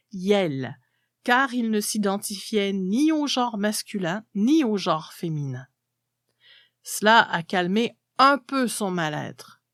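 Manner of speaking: usual speaking voice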